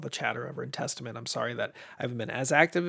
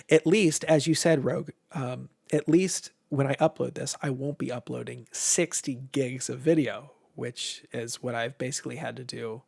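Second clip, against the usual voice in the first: ordinary talk, nothing marked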